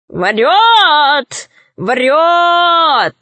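high, long-drawn bird cry; Дурында. A narrator's reading. Bird in the sky denounces the witch, drawn-out cry. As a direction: squawk each word out long. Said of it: "крикнула с неба птица Дурында".

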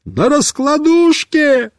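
high, slow yelled answer; shouting. Acted. Boy yells back from the car, shouting. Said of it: "Я кричу".

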